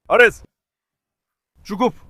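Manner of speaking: loud sharp call of the name; scolding shout. Real, not acted. Owner scolding the noisy donkey, loud. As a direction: snap the name at him.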